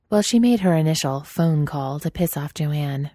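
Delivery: usual speaking voice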